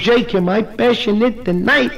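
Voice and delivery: high, slow amorous wife; mock passion. Wife in bed to her husband, hammed-up passion. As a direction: draw it out, rising with comic desire.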